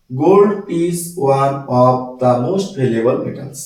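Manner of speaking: each word spaced out very slowly for the class; spelling it out slowly for note-taking; slow dictation. Shown as a demonstration. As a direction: dictate slowly so students can write. Teacher dictates the sentence.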